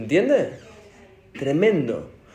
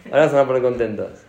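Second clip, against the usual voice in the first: usual speaking voice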